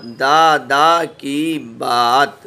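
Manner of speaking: दादा की बात drawn out very slowly, each word held; slow reading drill. Shown as a demonstration. Teacher reading the joined words slowly to pupils. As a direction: read दादा की बात slowly for the class to follow. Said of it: "अब ये मिला के पढ़ा".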